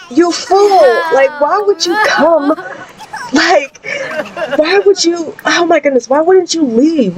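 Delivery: put-on high, frantic scolding teen voice; exasperated scolding. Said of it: "and London's like".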